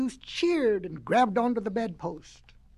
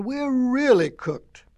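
slow, dread-filled and louder; worried cry; 3 semitones higher, 5 dB louder than usual. A narrator's reading. Pigs fear they will be cooked, slow worried cry. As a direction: despair. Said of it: "cried the little pigs".

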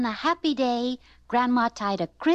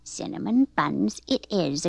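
lower, measured agreement; easygoing, lower grandma voice; agreeing grandma; 5 semitones lower, 4 dB quieter than usual. A narrator's reading. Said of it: "agreed Grandma".

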